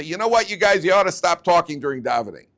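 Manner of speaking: loud, high scolding of the class; mock rebuke. Rabbi scolding his class, loud and high. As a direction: scold the guys for talking in shul.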